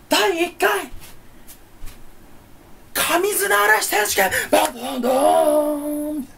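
high, slow hammed-up emcee call; mock announcer. Vlogger announcing a made-up championship, hammed-up high emcee call. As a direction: announce it like a cheesy tournament host.

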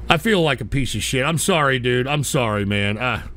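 low, deflated apology; dejected apology. Real, not acted. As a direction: sink down in guilt as you apologize. Host apologizes, feels bad.

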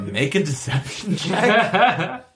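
slow words through laughter; laughing. Real, not acted. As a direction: say it while still cracking up. GM still laughing.

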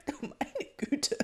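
high, slow, choked croak. A narrator's reading. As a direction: croak it out after a coughing fit. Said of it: "krächzte sie".

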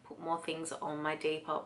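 ordinary talk

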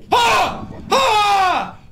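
frantic high no no no; panic, scream it out; panicked screaming. Real, not acted.

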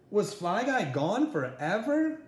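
ordinary delivery

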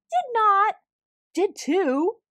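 hoog en luid heen en weer snauwen; kibbelen. Acted. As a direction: kinderachtig ruziën, luid. Kibbelende dieren maken ruzie.

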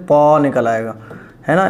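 normal talking voice

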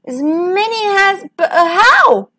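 bewildered high-voiced question; astonished stammer. A narrator's reading. Corporal astonished by stranger's offer.

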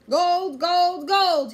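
high, very loud pirate roar; exultant cry. A narrator's reading. Captain Bones seizing the gold, exultant roar. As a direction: cry out in gold-mad triumph. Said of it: "he cried".

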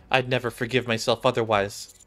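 normal talking voice

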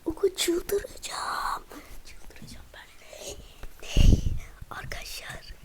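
whispered squeal, fading to breath; quiet excitement. Real, not acted. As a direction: whisper it, bursting but keeping quiet. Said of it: "Sessiz konuşuyorum". (heyecanla fısıldayarak)

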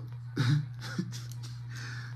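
real breathless laughter; fail to hold in the laugh; helpless laugh. Real, not acted. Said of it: "me I try hard for no laugh".

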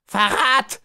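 very high-pitched cry of outrage; screamed alarm. A narrator's reading. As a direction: scream the accusation. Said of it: "schrie Bakaliko".

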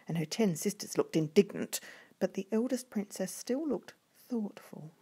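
plain reading voice

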